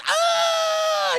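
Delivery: loud high-pitched scream; long, piercing imitation scream; mock scream. Woman screams after wandering off, loud mock shriek. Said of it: "She starts screaming".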